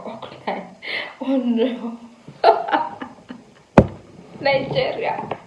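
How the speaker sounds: stunned giggling disbelief; breaking into laughter at the news; laughing. Retold or imitated. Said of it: "I just laughed".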